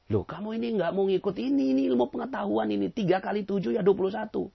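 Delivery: high, loud, fast indignant lecture; teacher losing patience with the stubborn man; exasperated teacher. Acted. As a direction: argue back high and indignant. Teacher argues back in exasperation.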